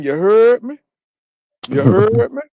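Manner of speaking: mock New Orleans catchphrase; New Orleans accent. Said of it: "my cousin ... New Orleans".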